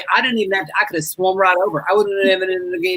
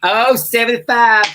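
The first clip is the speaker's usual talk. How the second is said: slow, louder final bingo call; 3 semitones higher, 5 dB louder, much slower than usual. Acted. Bingo host calling the final number on the board. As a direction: announce the last ball with weight. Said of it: "final number on the board".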